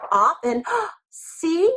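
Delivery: a little gasp, then a high, slow "see"; mock wonder. Shown as a demonstration. Teacher to the class, revealing the traced hand, with mock wonder. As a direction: show the class it's a surprise. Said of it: "reveals the traced outline".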